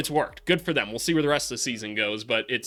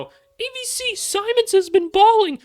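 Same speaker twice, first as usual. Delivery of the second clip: annoying high voice; 7 dB louder, slower than usual. Retold or imitated. Mocking an annoying commenter voice.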